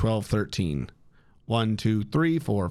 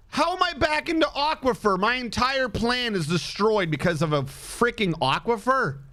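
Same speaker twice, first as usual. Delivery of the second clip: exasperated; 7 semitones higher than usual. Real, not acted. Aquifer ruins his fortress plan.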